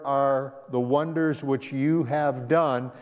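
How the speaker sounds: ordinary delivery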